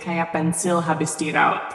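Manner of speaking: ordinary delivery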